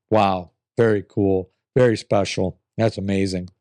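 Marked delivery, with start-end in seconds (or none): none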